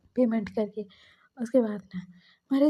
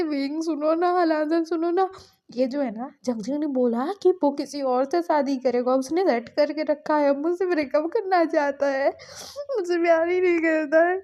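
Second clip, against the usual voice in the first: higher, tearful, whining plea; crying complaint; 4 semitones higher than usual. Retold or imitated. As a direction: plead through tears to friends. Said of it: "बोल के वो रो रहे थे". (रोते हुए शिकायत)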